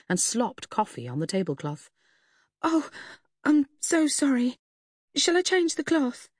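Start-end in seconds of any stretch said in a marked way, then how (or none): 2.6-6.3 high, startled and apologetic; flustered waitress, fast and high; flustered apology. Acted.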